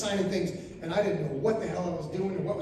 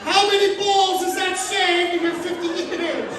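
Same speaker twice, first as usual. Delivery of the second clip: higher and louder, yelling in disbelief; comic outraged yell; outraged shout; 9 semitones higher, 6 dB louder, slower than usual. Real, not acted.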